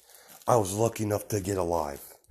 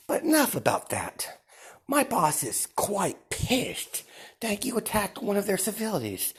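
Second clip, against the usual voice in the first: menacing villain Deku; 10 semitones higher than usual. Acted. Deku telling the villains they angered his boss, high and taunting. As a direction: lord it over them, savouring each threat.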